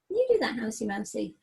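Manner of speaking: ordinary delivery, nothing marked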